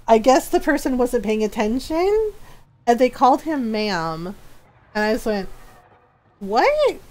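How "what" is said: high-pitched mock-shocked "what?"; startled disbelief. Hearing a stranger call Ron ma'am, high startled disbelief.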